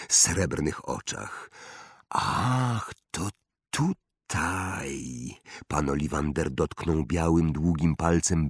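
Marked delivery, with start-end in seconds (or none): 2.1-5.3 drawn-out, halting wonder; wandmaker, hushed discovery. Acted. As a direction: linger on each word as if examining something. (powolne, zadumane odkrycie)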